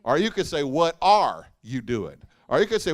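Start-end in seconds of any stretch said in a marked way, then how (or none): 0.6-2.2 "are" lifted and held, slow and firm; slow, heavy "are" in the middle; stress on ARE. Shown as a demonstration. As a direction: press on "are", as if in disbelief. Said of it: "we could preach that four different ways".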